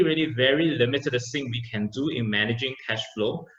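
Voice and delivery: ordinary delivery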